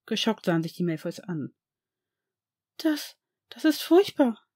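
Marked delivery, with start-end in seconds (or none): 2.8-4.4 higher, slow, stumbling over the first word; shaken, barely getting the words out; horrified stammer; stammering in shock. A narrator's reading. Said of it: "stotterte Narcissa".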